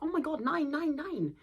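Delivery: high, panicky imitation of a frightened bride; mock scared. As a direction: squeak out a hammed-up panic. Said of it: "She's scared".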